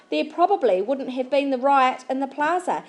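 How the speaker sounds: ordinary delivery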